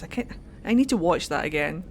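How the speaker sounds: ordinary talk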